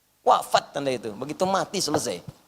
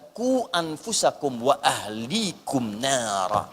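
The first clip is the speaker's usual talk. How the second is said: slow, low, drawn-out recitation; Quran recitation; 6 semitones lower, much slower than usual. Real, not acted. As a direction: recite the verse slowly and solemnly. (tilawah pelan bernada rendah)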